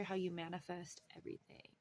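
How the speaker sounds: breathy whispered emphasis. Acted. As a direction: drop to a hushed whisper for effect. Dramatic close of a reading.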